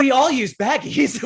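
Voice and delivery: hammed-up gushing chorus; mock excited exclaim. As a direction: proclaim it like a happy discovery. Guests excitedly relating to gruesome news.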